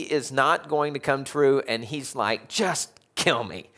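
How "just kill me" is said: drawn-out, hammed-up plea; mock dramatic. Preacher mocking Jonah's reaction to failed prophecy, mock dramatic. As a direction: stretch it out with mock despair. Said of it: "a little dramatic".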